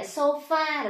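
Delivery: sofa with a full, drawn-out "fa"; common Vietnamese pronunciation. Retold or imitated. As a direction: say sofa Vietnamese-style, both syllables full. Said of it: "Cái từ này chúng ta hay đọc là gì". (sofa đọc kiểu Việt, "pha" rõ)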